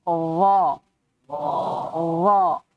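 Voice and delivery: Allah with a heavy, dark l, low; thick Allah. Shown as a demonstration. Teacher shows the thick Allah. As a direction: darken the l in Allah. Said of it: "Kenapa bisa Allah tebal".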